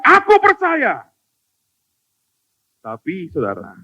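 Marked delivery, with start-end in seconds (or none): 0.0-1.1 high shouted cry of faith. Acted. Desperate father crying out to Jesus, high shout. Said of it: "Segera ayah anak itu berteriak".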